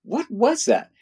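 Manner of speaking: very high-pitched retold bewilderment; baffled disbelief. Visitor asking the translator about the elder, baffled disbelief. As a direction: replay your confusion, voice shooting up high.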